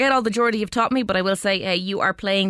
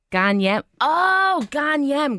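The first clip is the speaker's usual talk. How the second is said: higher-pitched stab at Geordie; attempted Geordie accent; 7 semitones higher, 3 dB louder, much slower than usual. Shown as a demonstration. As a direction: try out the Geordie phrase. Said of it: "I can't get the Geordie accent".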